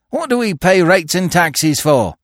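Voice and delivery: high, loud indignant shout; jailed thief shouts indignantly through the grating; indignant demand. A narrator's reading. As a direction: demand it with outrage. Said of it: "indignantly demanded Billy".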